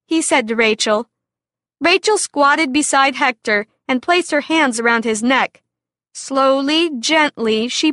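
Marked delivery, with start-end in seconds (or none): none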